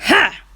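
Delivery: loud scornful "Ha!"; snort. Acted. Farmer mocks the bunnies, loud snort. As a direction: snort with contempt. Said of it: "he snorted".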